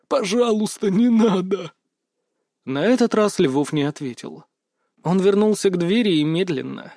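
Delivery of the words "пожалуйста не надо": desperate begging. A narrator's reading. Begging for mercy.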